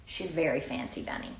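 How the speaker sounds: ordinary delivery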